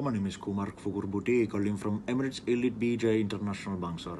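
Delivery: exaggerated Indian call-center accent; mock call-center accent. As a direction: play a scammy call-center agent with a thick accent. Scam caller sketch voice.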